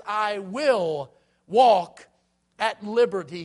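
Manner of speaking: slow, loud proclamation. Acted. Pastor quotes psalmist emphatically.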